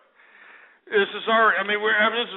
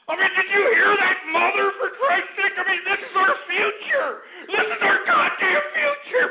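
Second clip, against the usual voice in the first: crying shout; 8 semitones higher than usual. Real, not acted. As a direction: wail it out in despair. Ranting host peaks in fury.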